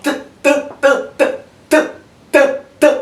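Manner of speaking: short unvoiced t sound, no vowel after it; bare consonant t. Shown as a demonstration. Teacher drilling the class on a bare t sound. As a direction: tap out t with no vowel, over and over. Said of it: "you pronounce it as what?".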